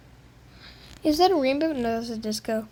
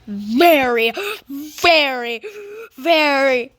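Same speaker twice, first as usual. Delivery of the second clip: loud, drawn-out mock distress; loud exasperation; 7 dB louder, slower than usual. Gamer lamenting a harvest, loud and exasperated. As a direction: belt out each word separately.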